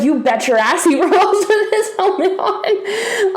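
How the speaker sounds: gleeful, laughing confirmation. Retold or imitated. Retelling answer to Perry's mom.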